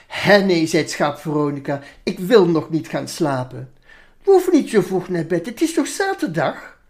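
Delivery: put-on lively, sing-song reading of a children's poem. Reading the Veronica poem aloud for the interviewer, animated. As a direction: read it out like a storyteller to kids.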